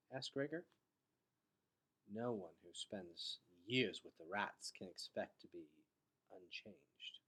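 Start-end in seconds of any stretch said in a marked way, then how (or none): 2.1-7.2 low, soft and gentle; gently. A narrator's reading. Doctor telling Gregor about his father, gently. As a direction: ease the blow softly, lower and quieter. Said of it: "said Solovet gently".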